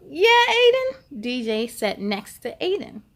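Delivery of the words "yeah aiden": friend's reply. A narrator's reading. Friend answers on bus.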